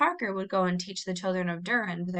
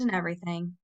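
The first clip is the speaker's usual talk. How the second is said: ordinary delivery, nothing marked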